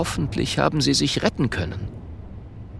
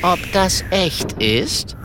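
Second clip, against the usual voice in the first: slow, higher-pitched doubt; doubtful question; 6 semitones higher, slower than usual. A narrator's reading. Kokosnuss staring at the strange creature, doubtful. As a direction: ask slowly, unbelieving.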